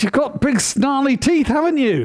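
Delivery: a mother losing patience; mother bear voice. Acted. Mother bear to her doubting cub, high and insistent.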